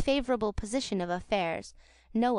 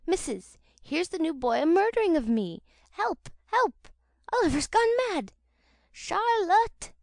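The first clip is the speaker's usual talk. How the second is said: high, loud cries for help; panicked shouts; 9 semitones higher, 5 dB louder than usual. A narrator's reading. Noah yells to the women of the house, panicked screams for help. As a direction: bawl for rescue as if being killed. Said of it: "Noah's shouts".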